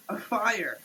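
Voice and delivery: ordinary talk, nothing marked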